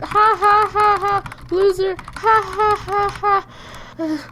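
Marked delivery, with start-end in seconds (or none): 0.0-1.5 spelt-out fake laugh; slightly higher mocking ha-ha; deliberate laugh at someone's expense. Mocks the other for a typo. 2.0-3.5 spelt-out fake laugh; deliberate mocking ha-ha. Mocks the other for a typo.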